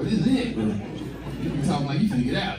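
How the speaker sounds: ordinary talk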